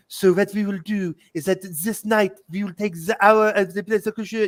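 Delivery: hammed-up French accent, pitched high; farcical French accent. French resistance lady telling the plan, farcical French accent. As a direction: imitate a comically exaggerated French accent. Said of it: "exaggerated farcical accent".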